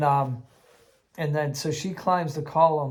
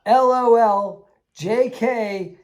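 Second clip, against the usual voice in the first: slow, loud, higher teasing spirit voice breaking into laughter; mocking spirit; 5 semitones higher, 6 dB louder, slower than usual. Kid's spirit trolling the second player at the altar, laughing. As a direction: taunt them like a prankster ghost.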